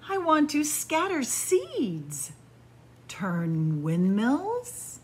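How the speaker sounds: slow, lilting storybook read; reading to children. Acted. Storyteller reading the windy-day book to kids, lilting. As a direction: savour each image for little listeners.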